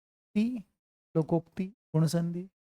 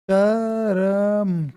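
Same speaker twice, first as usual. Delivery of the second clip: drawn-out demo; much slower than usual. Shown as a demonstration. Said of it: "करके दिखाओ पहले".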